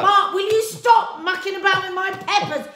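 high, loud mock-exasperated telling-off; hammed-up nagging at a husband; wife hamming up a scolding over her peppers.

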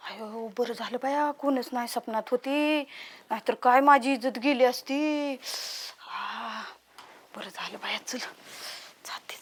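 low, hushed mutter to herself; very soft and low, thinking aloud; relieved self-talk. Acted. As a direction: murmur with relief, fading to a whisper. Alone, relieved after a dream.

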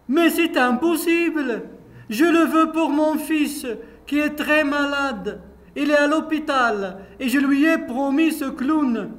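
upset woman protesting. Acted. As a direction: insist the clown is hers.

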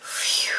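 breathy unvoiced phew of relief; relieved exhale; whispered phew. Shown as a demonstration. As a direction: blow out a soft sigh of relief. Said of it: "Another interjection that we have is this".

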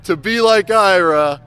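high, very loud, drawn-out appeal; mock commercial pitch. Band member recruits a guitarist in a fake ad, loud hammed-up appeal. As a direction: oversell it like a cheesy ad.

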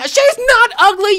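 high, loud defensive snap; defending Sombra's skin with a loud, indignant retort. Real, not acted. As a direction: shut the critics down hard. Snapping back at critics.

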